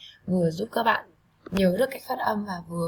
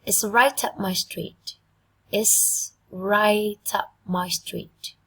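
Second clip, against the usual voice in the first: slow and clear, stress on right; slow model idiom; 3 dB louder, much slower than usual. Shown as a demonstration. Teacher modelling an idiom, slow and clear. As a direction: spell it out slowly for the class. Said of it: "Một thành ngữ rất là thú vị".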